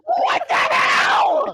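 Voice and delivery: high, loud, slow What the hell; shocked shout. Real, not acted. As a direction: yell in disbelief, drawn out. Shocked yell at the game.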